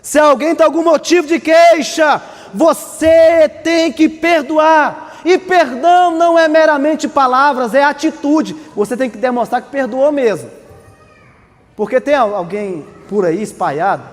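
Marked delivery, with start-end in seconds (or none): 0.0-8.5 loud slow preaching; shouting preacher. Acted. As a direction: hammer it home slowly.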